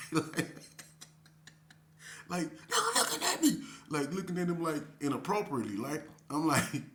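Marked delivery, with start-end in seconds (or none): 2.7-3.6 high indignant squeal, a bit less loud; mock shriek. Retelling the cellmate's complaint a second time, mock squeal. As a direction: squeal it out again, outraged.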